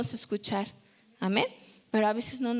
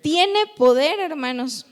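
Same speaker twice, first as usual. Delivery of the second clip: normal talking voice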